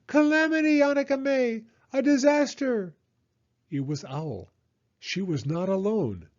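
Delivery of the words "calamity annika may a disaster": high, loud screeching owl; an alarmed owl sounding the alarm; Owl's screech. A narrator's reading. Owl crying disaster to Annika May, a high screech. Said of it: "familiar screech".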